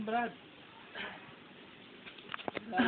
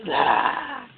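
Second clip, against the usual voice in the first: short, loud high "ah"; sharp loud exclamation; sudden yell; 8 semitones higher, 12 dB louder than usual. Real, not acted. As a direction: let out a sudden loud cry. Sudden cry amid banter.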